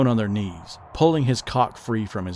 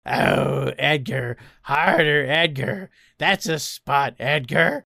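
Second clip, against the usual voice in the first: higher gargling goblin cry; haggard goblin; 4 semitones higher, slower than usual.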